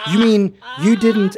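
alarmed butler. Pretend. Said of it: "And he's like".

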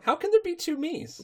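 high voiced baffled pony; incredulous Twilight. Twilight facing her double, high and baffled. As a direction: squeak out the disbelief.

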